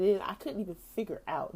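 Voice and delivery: ordinary talk, nothing marked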